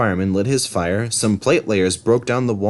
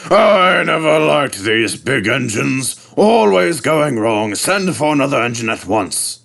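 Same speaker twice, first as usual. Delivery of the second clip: grumpy director; 4 semitones higher than usual. Acted. Fat director grumbling.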